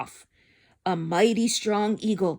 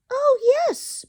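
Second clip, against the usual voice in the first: much higher, louder piped reply; piping; 5 dB louder than usual. Acted. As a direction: chirp it like a tiny person. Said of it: "piped the voice".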